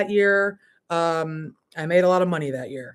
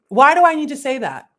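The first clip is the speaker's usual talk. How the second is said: higher, louder complaint; arguing back; 8 semitones higher, 8 dB louder than usual. Real, not acted. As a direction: resist them out loud. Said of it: "how I argue with them".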